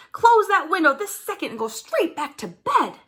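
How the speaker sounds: high, sharp angry order; a mother angrily sending her child back to bed. Acted. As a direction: snap at the child from the garden. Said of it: "She's really angry now".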